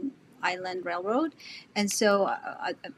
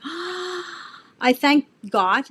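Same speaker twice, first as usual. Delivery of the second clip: gasp of awe; 6 semitones higher, 5 dB louder, much slower than usual. Retold or imitated. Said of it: "I'm the first one that goes like".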